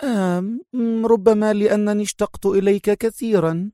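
high, mock-sweet sarcasm; sarcastic. A narrator's reading. Sonia pretends she missed her ex-husband, sarcastic. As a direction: feign longing in a high, coy voice. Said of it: "أجابته ساخرة".

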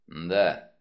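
low, curt agreement. A narrator's reading. Wolfe answers the smirking detective, curt and low. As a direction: say it low and abrupt. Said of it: "отрывисто сказал Вульф".

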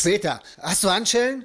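no time to explain, just get the cuffs; urgent demand. Acted. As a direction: agent bursting in, urgently demanding handcuffs. Agent urgently demands handcuffs.

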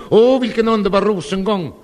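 high, loud gushing cry of delight; nervous exclaim. Retold or imitated. Nervous suitor gushing at the wrong sofa, loud exclaim. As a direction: gush over the sofa, too loud and nervous. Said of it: "rusade jag fram till soffan och ropade".

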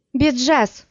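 sharp high call of a name; exclaiming. A narrator's reading. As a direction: cry the name out in alarm. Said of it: "воскликнула Дхури".

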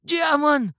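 high, loud cry of alarm; high-pitched, panicked shout; old man's yell. A narrator's reading. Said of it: "завопил старик".

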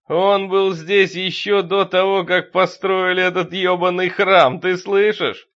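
loud, high, slow and emphatic; fanatical rant. A narrator's reading. Deacon rants about the church's ancient master, fanatical and loud. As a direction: hammer each word at full voice.

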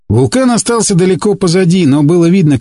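plain reading voice

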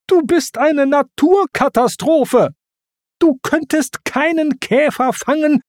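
high-pitched, exasperated tirade; indignant high scolding; Timon's angry rant. A narrator's reading. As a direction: rant in outrage, stretching the key words. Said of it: "brüllte Timon".

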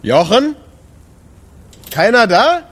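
high, loud call into the dark club; calling into an empty room. Acted. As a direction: shout for Jochen, unsure anyone's there.

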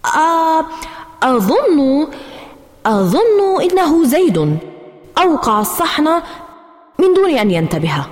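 high, hesitant, caught out; flustered excuse. A narrator's reading. Girl caught by mom, flustered and pitched high. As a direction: stammer out a blame on your brother. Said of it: "ارتبكت وقلت بسرعة".

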